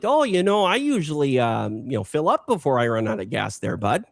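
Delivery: mock Minnesota nice, bud included. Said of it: "They're also gonna be like".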